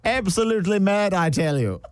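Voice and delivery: hammed-up posh Prince Charles nerves; nervous Prince Charles. Posh Prince Charles gossiping nervously about a coworker. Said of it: "This is nervous Prince Charles".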